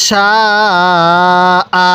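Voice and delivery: "সা" held very long, then a short "আ"; long madd. Shown as a demonstration. Teacher shows the four-alif rule, long drawn-out "সা" then short "আ". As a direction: stretch "সা" four counts before cutting to "আ". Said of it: "এরকমভাবে শিখব".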